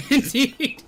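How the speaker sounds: high, loud laughed-out word; laughing, pitched well up. Real, not acted. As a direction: burst into laughter as you say it. Laughs while repeating a word.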